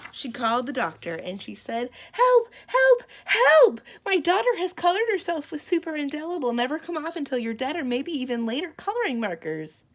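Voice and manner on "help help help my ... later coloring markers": very high, loud frantic calling, rushing; panicked cry for help. Acted. Mother calling the doctor in panic, screaming. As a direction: scream down the phone.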